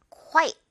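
Quite whispered in one syllable. Shown as a demonstration. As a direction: breathe Quite out with no voice. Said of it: "Quiet 아니죠?". (Quite 속삭이듯)

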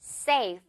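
"Safe" ending in an F blown through the teeth; correct F. Shown as a demonstration. Teacher corrects the ending to a real F. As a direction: end "Safe" with lip and teeth air. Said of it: "토끼 이빨 보여주면 돼요".

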